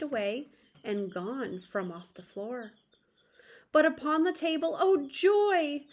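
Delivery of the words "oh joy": ordinary delivery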